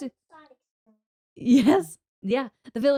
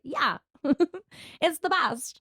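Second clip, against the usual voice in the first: high, laughing fan voice; mock a gushing viewer; imitating viewers; 7 semitones higher, slower than usual. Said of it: "the people who watch are like".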